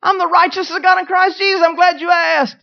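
high, loud triumphant comeback; loud, high, emphatic retort; bold shouted declaration. Acted. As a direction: fire it back as a shouted proclamation. Acted bold reply to challenge.

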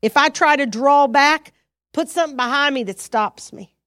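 fervent prayer spoken aloud. Shown as a demonstration. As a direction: pray it out with force. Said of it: "So you say".

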